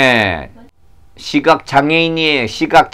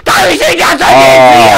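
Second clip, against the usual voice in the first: strained cry for Jesus; persistent shouting; 3 semitones lower, 3 dB louder than usual. Acted. Blind man calling on and on, persistent shouting. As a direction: keep crying out desperately. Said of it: "계속 소리를 쳤어요".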